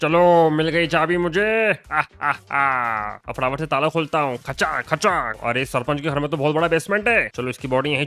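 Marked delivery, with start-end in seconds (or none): 1.9-3.2 slow low villain laugh; drawn-out gloating aah; evil laugh. Acted.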